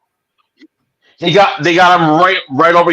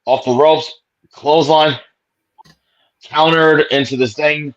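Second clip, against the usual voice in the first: ordinary talk, nothing marked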